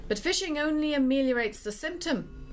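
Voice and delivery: ordinary delivery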